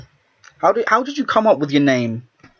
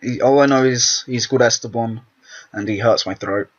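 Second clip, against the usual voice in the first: ordinary talk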